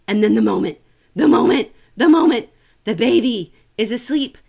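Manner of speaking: mounting dramatic buildup; building suspense. Shown as a demonstration. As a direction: build it up, rising each time. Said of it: "building suspense".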